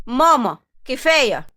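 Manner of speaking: loud, slow, stern call; firm interruption. A narrator's reading. Son stops his mother attacking his wife, loud and firm. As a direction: cut the quarrel off loudly, stretching the words.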